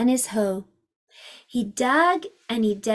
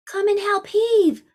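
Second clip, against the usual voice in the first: put-on boy's call; 8 semitones higher than usual. Boy calls the girl.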